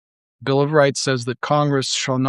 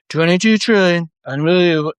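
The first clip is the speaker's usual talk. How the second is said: higher and louder, hammed-up astonishment; mock amazement; 4 semitones higher, 4 dB louder than usual. Mocking tech boosters, he gushes in a higher, louder voice. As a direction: gush over the number like a salesman. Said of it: "You saw me do that for, like, the last two years".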